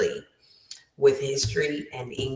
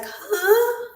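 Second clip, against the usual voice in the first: very high-pitched, baffled huh; hammed-up head-scratching confusion; mock bewilderment. Student hearing the teacher say write that, comically bewildered. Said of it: "I was really scratching my head".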